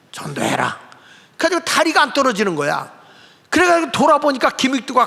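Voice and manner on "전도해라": a much lower, quieter voice speaking from above; voice of the Lord. Retold or imitated. The Lord commands the preacher, low and solemn. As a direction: speak as God, low and calm. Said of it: "주의 음성이 다시 들려".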